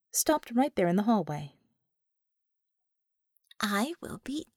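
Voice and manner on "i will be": whispered under the breath in disbelief; stunned whisper. Acted. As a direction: breathe it out as an idea dawns. Stunned realisation in hallway.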